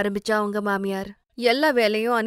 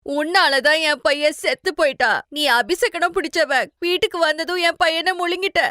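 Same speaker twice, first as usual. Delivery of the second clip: mother-in-law accusing daughter-in-law, raised angry voice; blaming; 7 semitones higher than usual. Acted. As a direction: hurl the blame at her, much higher. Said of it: "அவ மேல பழிய போட்டாங்க".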